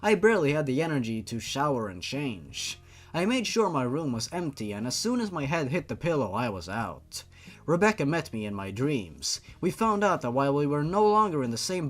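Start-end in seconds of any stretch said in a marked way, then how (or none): none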